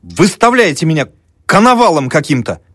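high, affronted student's protest; indignant student. A narrator's reading. Student objecting to being taken for a quack, indignant. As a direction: raise the pitch in offended protest.

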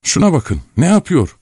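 high-pitched narrated cry; shouting. A narrator's reading. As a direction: call out in mock innocence, voice jumping high. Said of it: "diye bağırdı". (tiz sesle bağırarak)

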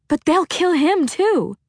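fearful. A narrator's reading. Freya afraid for Yoki.

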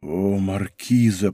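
slow, low, softened exclamation; deep emotion. A narrator's reading. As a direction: breathe out the address slowly, deeply moved. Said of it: "произнёс Фуке с волнением".

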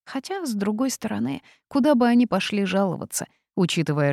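ordinary delivery, nothing marked

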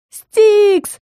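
very high calling out; acted high-pitched scream of a name; desperate cry. A narrator's reading. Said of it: "отчаянно закричала я".

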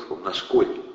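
ordinary delivery, nothing marked

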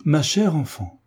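narrated alarm, not shouted; anxious exclamation. A narrator's reading. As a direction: plead with concern, pitch lifted. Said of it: "avec la plus vive inquiétude".

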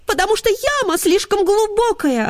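put-on high, whiny girlish voice; greyhound girls voice. A narrator's reading. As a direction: whine it like young hounds. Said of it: "ответили девочки". (высокий девичий голос)

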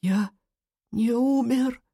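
high, halting question; relieved exhalation. A narrator's reading. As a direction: breathe it out in disbelieving relief. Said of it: "с облегчением выдохнул Сахли".

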